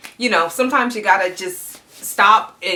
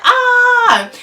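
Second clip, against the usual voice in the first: pained yelp; 8 dB louder than usual. Retold or imitated. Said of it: "And I'm like".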